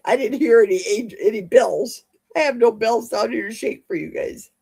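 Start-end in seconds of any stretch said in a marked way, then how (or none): none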